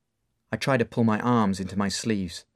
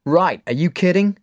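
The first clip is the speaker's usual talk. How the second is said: high loud scoff; blurted out; 9 semitones higher, 7 dB louder than usual. Acted. Dave scoffing at Mark's question: loud blurt. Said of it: "I blurt out".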